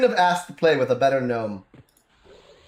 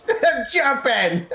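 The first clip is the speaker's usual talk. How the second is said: high, drawn-out gleeful voice; excited gnome Toby; 7 semitones higher, much slower than usual. Retold or imitated. Gnome showing off his jumps to friends, gleeful. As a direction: imitate a gleeful gnome, laughing through it. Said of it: "He's a gnome".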